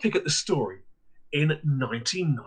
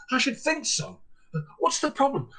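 much higher, prim indignant voice; a landlady ticking off a guest, pitched up; 12 semitones higher than usual. Retold or imitated. Landlady scolds the guest who fled her house, high indignant voice.

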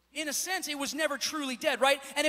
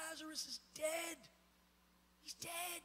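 very soft, hushed lament, lifting higher; mock weeping mourners; 4 semitones higher, 15 dB quieter than usual. Preacher acting mourners weeping around Martha, hushed grief. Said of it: "they're weeping, and they're crying".

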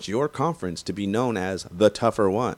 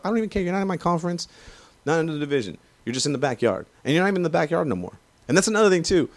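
much higher, worked-up dismissal; heated rant; 9 semitones higher, faster than usual. Real, not acted. Raider fan dismissing Niners fans, worked up and high. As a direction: brush the rival off, voice climbing.

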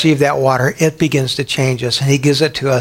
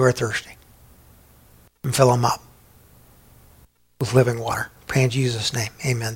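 prayer. Acted. Pastor prays over congregation.

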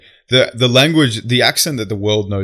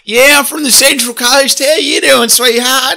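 mocking high-pitched, loud Aussie accent; Margot Robbie's Australian accent; 13 semitones higher, 6 dB louder, slower than usual. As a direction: do a hammed-up Central Coast Australian accent, pitched way up. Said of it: "Her accent's like".

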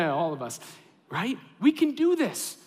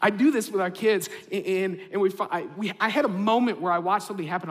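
usual speaking voice

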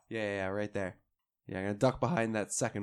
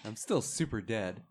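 usual speaking voice

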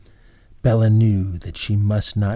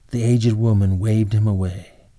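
plain reading voice

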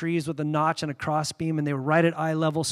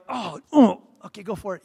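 mock meek; 3 semitones higher, 5 dB louder than usual. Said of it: "you're like".

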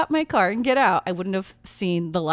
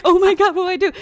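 panicked; 9 semitones higher, 7 dB louder, faster than usual. Retold or imitated. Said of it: "I'm like".

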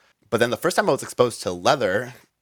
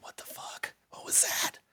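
whisper; 12 dB quieter than usual. Retold or imitated. Retold excited whisper to coworker.

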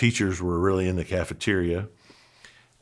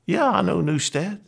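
much higher, astonished reply; retold amazement; 10 semitones higher than usual. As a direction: answer high-pitched, as if you can't believe it.

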